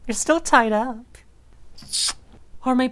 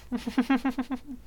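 quiet laugh; 5 semitones higher, 7 dB quieter than usual. Acted. Laughs softly between sounds.

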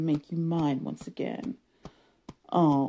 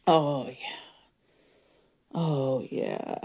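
normal talking voice

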